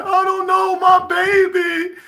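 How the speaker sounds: high, slow, hammed-up wailing on camera; mock a mother crying for the news cameras; exaggerated fake-sobbing mom; fake grieving mother. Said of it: "They, they".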